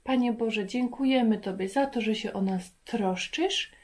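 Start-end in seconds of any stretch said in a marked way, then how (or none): none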